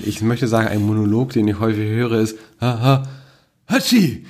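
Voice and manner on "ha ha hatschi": big theatrical sneeze; sneezing. Retold or imitated. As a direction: build up and burst into a sneeze. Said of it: "meine Freundin zitieren darf".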